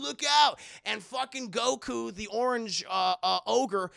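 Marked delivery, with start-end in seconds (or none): 0.0-0.6 loud high "look out"; warning shout. Retold or imitated. Kuwabara warning Botan, loud shout. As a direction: yell a warning.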